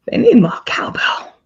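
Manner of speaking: breathy whispered celebrity drawl; slow, airy impression of Walken; Christopher Walken voice. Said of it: "That's one".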